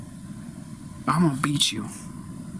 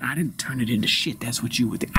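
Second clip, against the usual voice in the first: usual speaking voice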